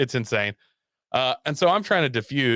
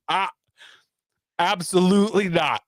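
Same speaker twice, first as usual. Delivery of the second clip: flinch back and refuse firmly; recoiling refusal; 8 semitones higher than usual. Retold or imitated. Woman pushes her head at him.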